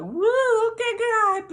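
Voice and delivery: very high, loud whoop; excited whoop. Real, not acted. Reliving God's confirmation, high excited squeal. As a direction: squeal it to God, thrilled. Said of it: "I just got excited, look, all over again".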